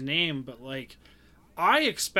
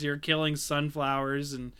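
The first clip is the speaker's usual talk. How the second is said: normal talking voice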